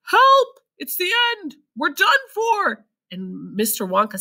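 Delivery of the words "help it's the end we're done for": high, loud cries for help; grandpa's panicked yelling; terrified shout. A narrator's reading. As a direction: scream as the roof breaks. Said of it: "Grandpa Joe shouted".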